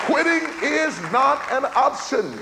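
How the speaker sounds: emphatic, high-pitched preacher climbing to a straining shout; full-force preaching. Real, not acted. Preacher urging listeners not to quit on life, impassioned shout. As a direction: drive each exhortation home with rising fervour.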